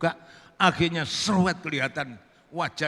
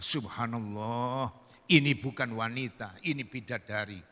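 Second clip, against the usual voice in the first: hushed awe; 6 semitones lower, 4 dB quieter than usual. Acted. Santri marveling at the beautiful woman, hushed awe. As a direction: stretch it out, low and soft.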